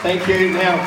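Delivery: normal talking voice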